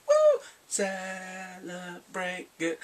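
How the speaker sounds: high whoop, then slow drawn-out chant; mock celebration. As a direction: cheer like a celebrating team. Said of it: "the team is like".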